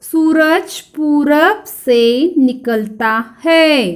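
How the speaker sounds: slow, word-by-word model sentence; drawn-out teacher dictation, separated words; repeat-after-me reading. Shown as a demonstration. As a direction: lead pupils slowly, stretching each word. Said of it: "फिर से मेरे पीछे पीछे दोहराओ".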